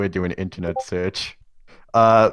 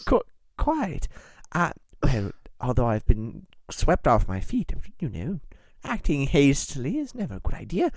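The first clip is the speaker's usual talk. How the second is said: in-character voice; 4 semitones higher, 3 dB quieter than usual. Acted. Character hesitates at proposal.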